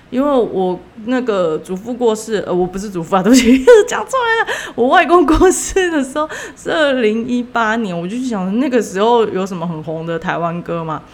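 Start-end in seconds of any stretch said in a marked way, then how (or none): none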